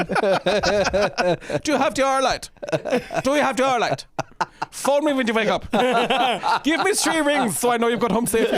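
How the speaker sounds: high, giggly mock-German accent. Soldier fussing over a drunk mate, in a German accent. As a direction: play it in a comic German accent, near laughing. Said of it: "still doing the German ac".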